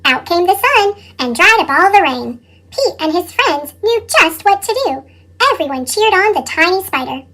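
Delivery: ordinary delivery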